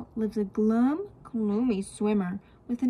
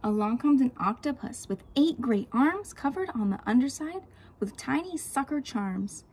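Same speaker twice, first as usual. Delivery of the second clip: usual speaking voice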